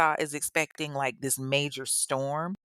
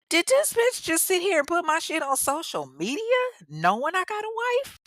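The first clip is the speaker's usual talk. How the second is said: high, incredulous outburst; outraged Quentin; 13 semitones higher than usual. Retold or imitated. Angry husband-hiding man reacting to Facebook post, high outraged squeal. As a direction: voice him shrieking in disbelief.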